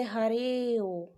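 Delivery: हरे ओ with the vowel held long; drawn-out pluta vowel. Shown as a demonstration. Teacher demonstrating the prolonged pluta sound. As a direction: stretch ओ in हरे ओ like a call. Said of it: "देर तक अ का बीच में उच्चारण है".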